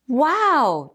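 high, mildly excited wow; cheering. A narrator's reading. Mouse delighted after the music, cheering. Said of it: "cheered Mouse".

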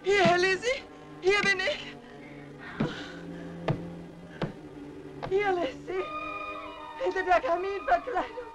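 muffled calling. Acted. Trapped behind panel, calling.

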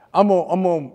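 ordinary talk

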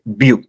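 ब्यू with a short French u, a little y in it; very small U. Shown as a demonstration. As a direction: say ब्यू briefly, no t at the end. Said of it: "बहुत ही छोटा pronounce". (ब्यू में बहुत छोटा यू)

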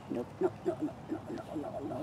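hushed, breathless string of noes; whispered warning to a pet; urgent whisper. Real, not acted. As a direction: whisper it fast so the bunny won't jump. Bunny about to jump.

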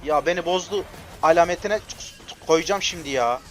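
loud, high angry threat; angry vow. Real, not acted. Gamer vowing to ult the enemy, loud angry threat. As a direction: vow revenge at full volume.

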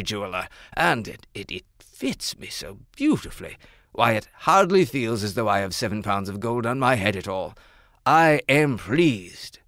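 admiring royal voice; pleased king. Acted. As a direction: savor the fine crown. King tries on crown.

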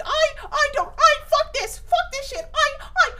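high, tight, spluttering anger; squeaky angry voice. Shown as a demonstration. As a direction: show how your voice goes up when mad. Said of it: "you know that I'm angry when my voice does this".

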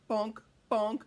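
usual speaking voice

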